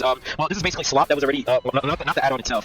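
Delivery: usual speaking voice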